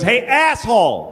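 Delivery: slow, heated mock yell; angry driver. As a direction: mimic an irate driver calling out. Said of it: "He was angry".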